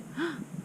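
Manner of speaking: sharp gasp of mock horror; shocked gasp. Real, not acted. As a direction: gasp as if it hurt. Reader reacts to the insult.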